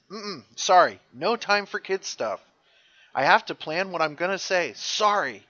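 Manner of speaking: high, animated mock dismissal; acted brush-off. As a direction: brush off a friend breezily. Acting McQuaid brushing Tyler off.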